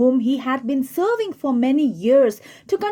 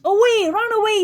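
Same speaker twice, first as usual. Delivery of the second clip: fiend urging; 9 semitones higher than usual. Acted. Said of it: "says the fiend".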